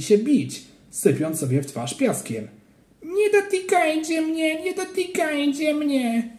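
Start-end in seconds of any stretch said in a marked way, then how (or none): 3.0-6.3 put-on high, slow, whining child's plea; frightened child crying out. A narrator's reading. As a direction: plead like a scared little boy. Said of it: "krzyczał Ananiasz". (wysoki, błagalny krzyk dziecka)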